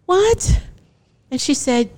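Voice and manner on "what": stunned. Retold or imitated. Stunned by birth mother's goodbye.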